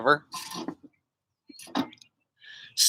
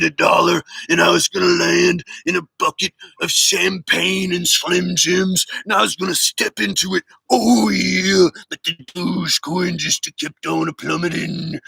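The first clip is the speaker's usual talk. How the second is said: Macho Man impression. Viewer asks for Macho Man, streamer does growling wrestler promo. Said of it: "Now Macho Man".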